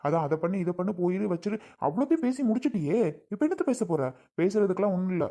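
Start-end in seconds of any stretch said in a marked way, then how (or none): 1.3-4.0 scornful. Acted. Husband mocking wife's belated talk.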